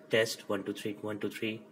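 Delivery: normal talking voice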